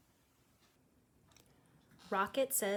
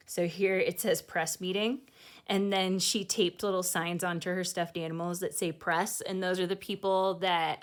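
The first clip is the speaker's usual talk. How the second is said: ordinary delivery, nothing marked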